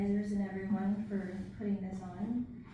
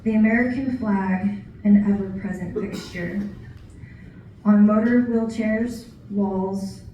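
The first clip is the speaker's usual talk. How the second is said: deliberate recital, paused between phrases; poetry reading; 8 dB louder than usual. Acted. Poet sharing what working at a veterans home taught, poetry reading. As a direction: deliver each line as verse, measured and projected. Reads own poem about veterans.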